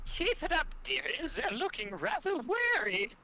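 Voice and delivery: high-pitched, put-on taunting voice; sing-song mockery. Spellcaster taunts a monster while dealing psychic damage, sing-song mockery. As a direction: taunt them in a high, mock-sweet voice. Casting a mocking spell at enemy.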